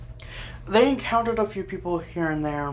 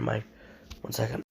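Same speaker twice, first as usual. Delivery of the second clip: sore throat voice; 6 semitones lower, 9 dB quieter, faster than usual. Real, not acted. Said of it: "sore throat".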